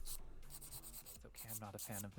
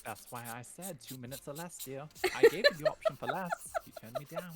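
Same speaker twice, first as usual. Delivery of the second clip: put-on amateur period-drama British accent; bad Downton Abbey British; 3 semitones higher, 11 dB louder than usual. Co-host reminds streamer of the shorter option, Downton Abbey British accent. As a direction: chide in stagey posh British. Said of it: "You sound like a bad Downton Abbey actor".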